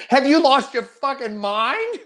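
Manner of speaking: high, slow, stretched disbelief; stunned, can't believe she said it; incredulous outburst. Retold or imitated. Son aghast at his mother insulting Al Gore, incredulous cry.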